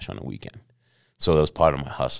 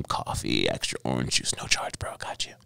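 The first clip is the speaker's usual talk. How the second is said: whispered, like slipping a favour; conspiratorial whisper; 7 semitones lower, 7 dB quieter than usual. Retold or imitated. Waiter quietly comping a regular's orange juice, whispering. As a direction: hush it down, just between us. Slipping free extras secretly.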